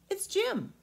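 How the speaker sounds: put-on high boyish voice; a small boy correcting the giant; boy Jim answering the giant; young boy.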